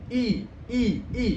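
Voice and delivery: "I" high, teeth pressed together; I gigi ditekan. Shown as a demonstration. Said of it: "giginya itu agak ditekan". ("I" dengan gigi agak ditekan)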